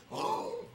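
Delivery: sharp, high surprised gasp; a sudden high intake of breath. Pretend. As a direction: gasp as if shocked. Mock gasp.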